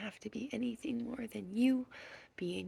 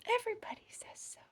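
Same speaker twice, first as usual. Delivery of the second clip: much higher and fast, still whispered; high quick whisper; 11 semitones higher, faster than usual. Acted. Mimicking everybody to a little friend in a high quick whisper. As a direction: chirp the repeat like a mimic.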